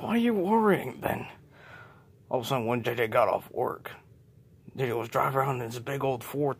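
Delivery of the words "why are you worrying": dismissive wife. Acted. Wife brushing off husband's worry.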